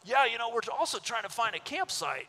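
high, put-on enthusiastic voice; imitating an overexcited stranger; eager tourist angler. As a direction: play a gushing, clueless vacationer. Same angler replies.

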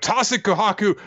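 high-pitched mock call-out; retold shout. Senku calling to Kohaku in a recap, mock shout. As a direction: imitate an urgent shout without the volume. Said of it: "is shouting out".

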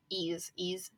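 breathy voiceless whisper of ease, ease; whispered echo. Shown as a demonstration.

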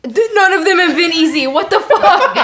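high, very loud protest; real shouted disbelief, half laughing; exasperated outburst. Real, not acted. Player protesting that no fight was easy, exasperated shout.

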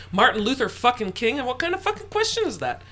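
retold blow-up at his birth mother; angry retort. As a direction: build from a hard push into an outraged cry. Retold heated argument with mother.

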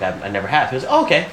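normal talking voice

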